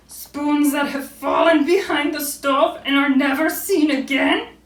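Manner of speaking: loud, high, slow mock wail; crying Owl. A narrator's reading. Owl cries into the kettle, loud hammed-up wail. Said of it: "Owl began to cry".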